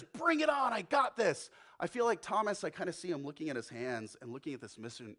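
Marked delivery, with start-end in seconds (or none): 0.0-1.5 loud, high, brash challenge; bold Peter. Acted. Peter tells God to bring it on, loud and brash. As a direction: Peter throwing down the gauntlet. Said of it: "where Peter says".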